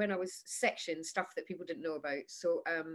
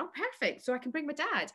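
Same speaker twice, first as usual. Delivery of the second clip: high delighted; 7 semitones higher than usual. Retold or imitated. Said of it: "And I went".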